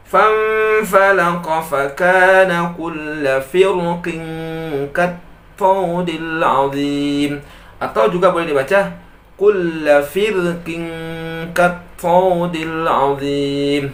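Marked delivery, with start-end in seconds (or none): 0.1-7.3 higher chanted tilawah; Quran recitation. Acted. Teacher recites the Quran verse for students, chanted. 9.3-13.8 higher chanted tilawah; Quran recitation. Acted. Teacher recites the Quran verse for students, chanted.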